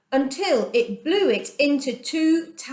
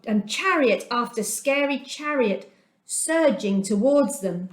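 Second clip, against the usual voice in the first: plain reading voice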